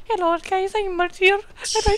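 high-pitched mock girlfriend voice; squeaky impression of his girlfriend; voicing Marzia. Doing his girlfriend Marzia's intro, high and mock. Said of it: "my beautiful girlfriend from Italy, Marzia".

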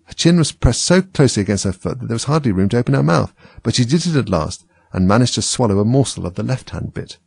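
ordinary delivery

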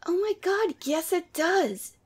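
insist, overjoyed to be right; excited protest. Acted. Arguing with a friend on the phone about burgers, excited.

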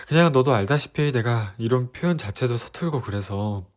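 normal talking voice

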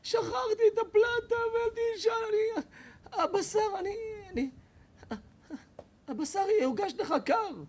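hammed-up high-pitched wife breaking into a cry; wailing wife. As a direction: wail in panic over the cold meat. Wife wailing about hotplate.

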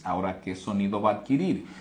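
ordinary talk

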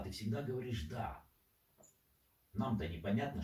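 normal talking voice

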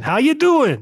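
high, loud mock Boston accent; hammed-up Boston greeting. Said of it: "I think I'll have to do Boston".

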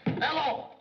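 loud, high blurted hello; shouted hello. Acted. Secretary overeager on the phone, loud high hello. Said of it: "I mean, hello".

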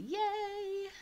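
ordinary talk, nothing marked

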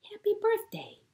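very high, sing-song happy birthday; bright birthday greeting. Acted. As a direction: chirp it up high with joy. Hedgehog greets Rabbit.

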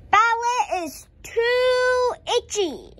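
high, slow loud whine; child whining in class; loud complaint. A narrator's reading. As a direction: complain loudly at ballet. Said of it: "But I was very loud".

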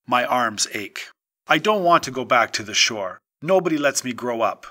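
usual speaking voice

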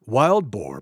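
usual speaking voice

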